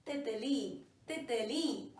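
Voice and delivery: तितली with the first त said fully, spelt out slowly; correct pronunciation. Shown as a demonstration. Teacher modelling the right word for students, said carefully. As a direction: say every त in तितली cleanly. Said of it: "ये वाला त भी हम पूरा बोलेंगे".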